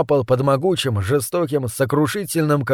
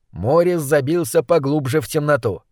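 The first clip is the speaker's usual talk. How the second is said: plain reading voice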